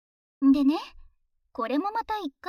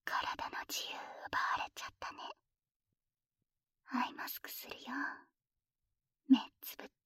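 whispering; 4 semitones lower than usual. Acted. Binding partner, whispering close.

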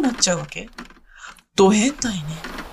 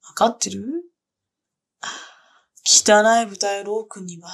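ordinary talk, nothing marked

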